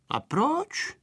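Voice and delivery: soft, high, careful question; cautious question. A narrator's reading. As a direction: ask warily, keeping it small. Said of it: "zeptal se opatrně Pepíček".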